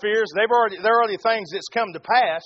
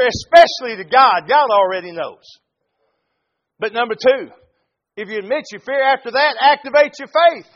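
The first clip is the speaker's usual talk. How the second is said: loud, fervent sermon delivery; preaching at full force; 3 semitones higher, 6 dB louder than usual. Acted. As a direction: preach it hard to the congregation.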